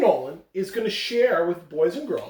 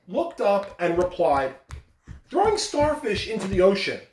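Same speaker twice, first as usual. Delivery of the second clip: story narration; 5 semitones higher than usual. A narrator's reading. Principal reads parable aloud.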